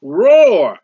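loud roared word like a lion; preacher roaring like a lion; lion's roar. Retold or imitated. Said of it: "he's describing like a, a lion".

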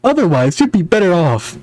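hero character voice. Acted. Hero voice in game dialogue.

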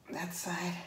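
soft breathy whisper. Real, not acted. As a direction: whisper it to yourself. Tying knots, whispered repeat.

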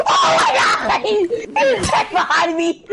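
high panicked shriek; panicked scream. Real, not acted. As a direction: shriek as the enemy closes in.

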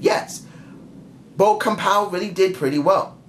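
usual speaking voice

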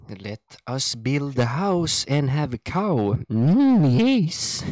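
slow, loud peasant voice ending high on yes; mock dim peasant. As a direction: ham up a slow yokel. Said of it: "Meanwhile, us".

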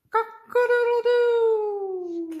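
high, loud, slow rooster crow. Pretend. Parent reading aloud, a high crowing rooster. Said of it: "He can sound like a rooster".

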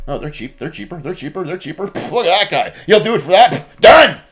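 starts murmuring over a list of bids, then bangs it down with a shout; hammed-up township buyer snapping up the cheapest bid, ending in a yell; mock township official. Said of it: "the township's looking at this list of companies going".

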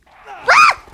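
short, high, loud yelp; sudden shriek of fright; startled scream. Real, not acted. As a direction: scream sharply at a jump scare. Bird jump scare in horror game.

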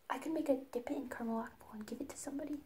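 quick, scheming whisper; fast whisper. Real, not acted. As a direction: rush the idea out in a whisper.

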